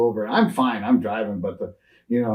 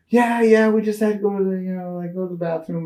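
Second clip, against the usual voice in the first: much higher, put-on innocent voice; innocent reply; 11 semitones higher, 5 dB louder than usual. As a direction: play it squeaky-clean for the cop. Answering cop with beers out.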